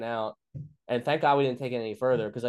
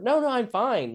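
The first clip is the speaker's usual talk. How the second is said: high, louder mock girlfriend voice; imitated protesting wife; 12 semitones higher, 5 dB louder than usual. Wife telling a cop she is safe, insistent. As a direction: hammer out her denial in a high register.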